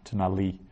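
Tanali as one word, stressing LI; stress on final syllable. Shown as a demonstration. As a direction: run Tanali together, landing on LI. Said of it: "accent on the li".

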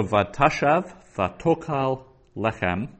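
TA in "vatashav", TO in "vatokal", LE in "lechem", all punched; penultimate stress. Shown as a demonstration. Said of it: "what we call the penultimate syllable".

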